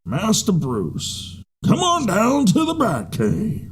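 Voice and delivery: slow, hammed-up Pacino butler; Al Pacino as Alfred. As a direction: drag it out like Pacino. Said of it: "Al Pacino is Alfred".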